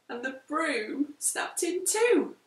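ordinary delivery

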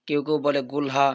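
normal talking voice

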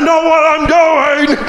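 high, drawn-out horse voice; stubborn horse. Retold or imitated. Horse walking into water answers rider, stubborn horse voice. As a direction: stretch it out as a dumb, sure-of-itself horse. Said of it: "He's like".

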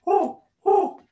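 high, loud bird call "Whoo, whoo"; raven call. Acted. Raven announcing himself to the birds, high call. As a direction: caw out like a raven. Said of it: "Raven, he walked up".

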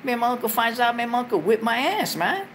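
high, anxious protest; nervous. Retold or imitated. To the girl making a move, high nervous worry. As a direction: panic about the uncle finding out. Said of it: "And I'm like".

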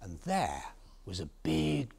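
ordinary delivery

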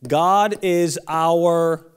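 slow and loud, every word drawn out; teacher calling out a memory verse for kids to repeat; slow lead-along recitation. Shown as a demonstration. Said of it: "Here we go. Ready?".

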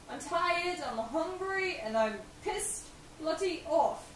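normal talking voice

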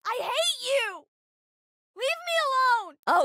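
upset, high-pitched yell; angry outburst. Acted. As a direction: lash out, voice way up high.

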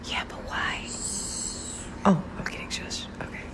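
unvoiced whispered back-and-forth; whisper yelling. Real, not acted. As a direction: whisper it, half teasing. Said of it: "Why are we whispering?".

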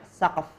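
"Saqf" ending on a bare f; no final vowel. Shown as a demonstration. Teacher showing students the reading, "Saqf" without a final vowel. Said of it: "Ini bacanya".

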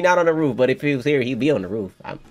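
ordinary delivery

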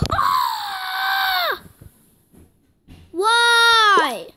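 very high, loud wail of protest; anguished scream. Real, not acted. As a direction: howl at the unfair death. Dies in game, screams.

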